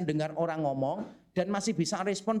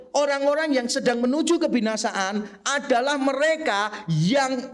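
full-force preaching; 5 semitones higher, 8 dB louder than usual. Acted.

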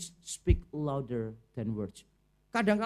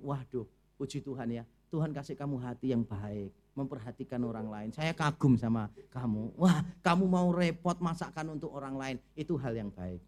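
lower, gentle, sincere-sounding compliment; tactful praise; 7 semitones lower than usual. Retold or imitated. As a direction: praise the heart, not the food, kindly. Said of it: "saudara puji gini". (pujian lembut dan tulus)

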